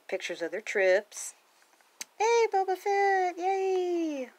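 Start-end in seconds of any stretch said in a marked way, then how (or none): 2.2-4.3 very high, delighted cheering; high-pitched drawn-out hooray; excited cheer. Real, not acted. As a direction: cheer in a high, long squeal. Cheers on seeing a favorite character.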